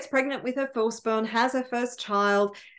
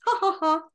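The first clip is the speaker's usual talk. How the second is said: higher-pitched gloating ha-ha-ha; little man's pleased chuckle; gleeful mock laugh; 5 semitones higher, faster than usual. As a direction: laugh smugly, as if winning. Little man skips away gloating.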